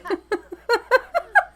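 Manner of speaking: high, loud burst of shocked laughter; shrieking high laugh. Real, not acted. Streamer laughing at the slap, a high shriek of laughter. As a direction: cackle in disbelief at the slap.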